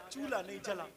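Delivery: normal talking voice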